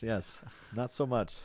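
ordinary talk, nothing marked

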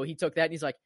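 ordinary talk

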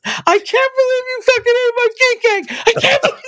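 high, loud hammed-up outrage; lost her shit. Husband mimics his wife's high, loud hammed-up disbelief. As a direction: squeal it like she can't believe it. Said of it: "lost her shit".

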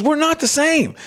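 high-pitched stand-up delivery, imitated; retold comedian. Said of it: "He's a comedian".

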